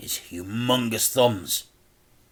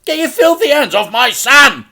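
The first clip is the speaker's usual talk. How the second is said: much higher, loud furious yell; father's shout; 12 dB louder than usual. A narrator's reading. Dad shouts at the henchmen holding Frank. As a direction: roar to protect your son. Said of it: "shouted Dad".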